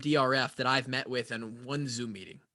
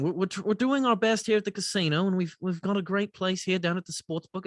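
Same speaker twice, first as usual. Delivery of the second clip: breezy British executive voice, lightly done; British accent; 3 semitones higher than usual. Retold or imitated. Retelling the CEO's pitch in a light British accent. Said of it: "Trying to figure out what accent you're trying to do".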